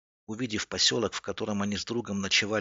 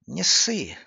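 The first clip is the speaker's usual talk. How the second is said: high, louder brush-off; cocky reassurance; 8 semitones higher, 5 dB louder than usual. A narrator's reading. Gleb brushes off his friend at the wall, cocky and grinning. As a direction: toss it over the shoulder with a grin. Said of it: "улыбнулся Глеб через плечо".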